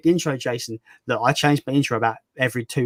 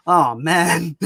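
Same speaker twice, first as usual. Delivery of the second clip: mock upset. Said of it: "he'll be, like, upset".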